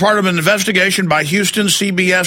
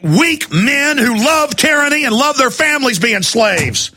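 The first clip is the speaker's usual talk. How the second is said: rising angry rant, pitched much higher and shouting; real fury pouring out at listeners; getting mad; 4 semitones higher than usual. Real, not acted. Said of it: "All right, I'm getting mad".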